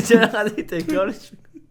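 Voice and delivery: laughing breathlessly, high; stifled giggle breaking through; suppressed laughter. Real, not acted. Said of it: "آقا، از اول زدی". (خندهٔ فروخورده)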